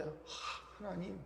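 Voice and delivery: breathed out, very soft "oh God"; hushed prayer sigh. Retold or imitated. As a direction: imitate a believer sighing to God under the breath. Imitates believers sighing in prayer.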